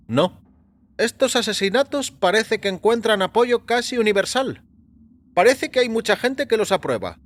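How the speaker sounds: lightly voiced doctor musing on the murders. A narrator's reading. A doctor tells his patient about the public's reaction, in a lifted voice.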